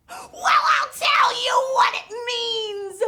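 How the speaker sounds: high, loud, excited shout; Miss Vocab. Acted. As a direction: burst out with it, thrilled. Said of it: "I'm Miss Vocab".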